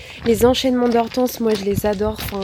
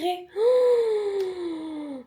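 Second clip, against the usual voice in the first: shocked gasp; 9 semitones higher than usual. Real, not acted. Shocked at own sweat.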